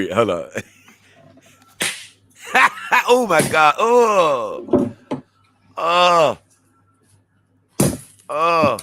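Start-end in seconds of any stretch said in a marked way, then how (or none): 2.4-3.0 shrieking laugh; loud burst of laughter. Real, not acted. Vlogger watching a disgusting cake video, loud laugh. 3.1-8.8 loud repeated oh my God; shocked disgust. Real, not acted. Vlogger watching the litter box cake video, loud shocked groans.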